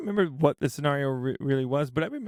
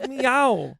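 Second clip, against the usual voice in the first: high little cartoon cat meow; 7 semitones higher, 3 dB louder than usual. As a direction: imitate a cat meowing. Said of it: "he goes".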